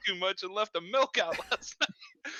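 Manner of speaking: squeaky giggling through words; barely holding in laughter; laughing. Real, not acted. Teasing question, cracking up.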